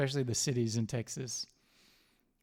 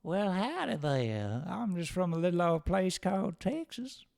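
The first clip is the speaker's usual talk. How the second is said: put-on Texan cowboy accent; 5 semitones higher than usual. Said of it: "I don't know what they do in Texas".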